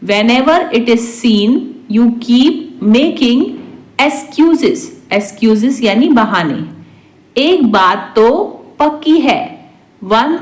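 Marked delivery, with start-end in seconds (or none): 0.0-4.9 measured, phrase by phrase; slow repeat. Shown as a demonstration. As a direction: pause before the last word. Teacher repeats translation word by word. (धीरे-धीरे, टुकड़ों में)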